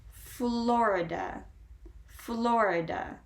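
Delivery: Florida slowly, with the middle drawn out; slow pronunciation demo. Shown as a demonstration. Teacher modelling a state name, slow clear Florida.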